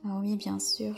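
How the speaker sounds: ordinary delivery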